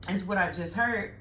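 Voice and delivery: talking while chewing gum. Real, not acted. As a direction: smack gum between the words. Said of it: "excuse the smacking".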